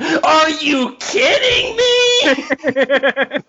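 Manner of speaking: a high, slow mock shout of the opening line; high, slow mock outrage. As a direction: shriek it like a scandalized preacher. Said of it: "when it begins with".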